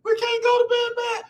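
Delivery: mock his younger self, high and whiny; whiny high-pitched mock of old self. Mocking his old self arguing at bedtime, high pleading whine. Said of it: "I used to be one of them dudes".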